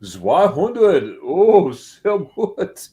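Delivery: loud, slow, drawn-out German delight; mock German amazement. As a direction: marvel in hammed-up German, stretching the words.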